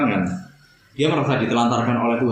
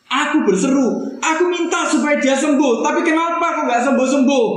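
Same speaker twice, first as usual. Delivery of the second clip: anguished plea; 12 semitones higher than usual. Acted. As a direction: beg God for healing, voice strained high. Voicing a pleading grieving believer. (rintihan memohon, tinggi dan lambat)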